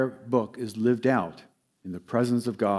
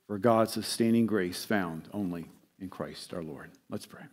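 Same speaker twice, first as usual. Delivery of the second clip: ordinary delivery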